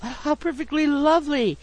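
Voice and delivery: high, swooping gush; effusive delight over something pretty; delighted exclamation. A narrator's reading. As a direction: exclaim in ladylike rapture. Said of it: "exclaimed Missus Brown".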